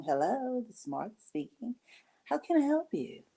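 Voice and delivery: sweet professional receptionist greeting; answering the phone at work in her work voice. Retold or imitated. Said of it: "work voice on".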